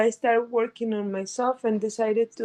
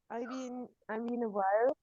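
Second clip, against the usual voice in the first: ordinary talk, nothing marked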